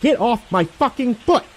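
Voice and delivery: high, slow desperate yell; shouting. Acted. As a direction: scream it at him with everything. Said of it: "I shout at him".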